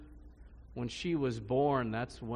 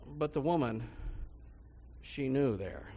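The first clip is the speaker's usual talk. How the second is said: usual speaking voice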